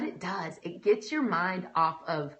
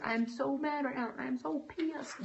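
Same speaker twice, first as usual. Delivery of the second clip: acted venting; 6 semitones higher, 4 dB quieter, slower than usual. Models honest venting to God.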